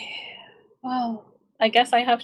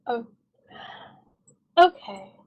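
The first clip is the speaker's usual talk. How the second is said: ordinary talk, nothing marked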